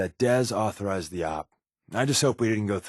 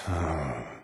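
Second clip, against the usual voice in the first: sigh; 7 semitones lower, 5 dB quieter than usual. Real, not acted. Sigh while scouting open ground.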